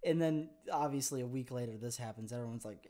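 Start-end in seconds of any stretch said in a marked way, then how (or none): none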